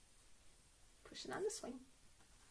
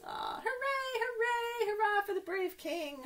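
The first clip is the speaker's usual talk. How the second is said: cheering; 9 semitones higher than usual. Acted. Cheering the king.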